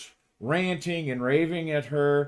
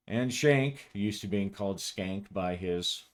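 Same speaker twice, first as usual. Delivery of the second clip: ordinary talk, nothing marked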